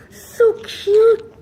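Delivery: high, loud squeal; squeal of cute. Real, not acted. Owner squealing over her bird, high.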